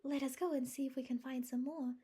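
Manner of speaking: high, loud eager suggestion. A narrator's reading. Anne eagerly trying to escape the moment. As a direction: jump at the chance to get away. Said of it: "suggested Anne eagerly".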